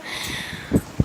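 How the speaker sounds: sharp surprised gasp, unvoiced; quick gasp of remembering; sudden gasp. Real, not acted. Sudden remembering mid-vlog.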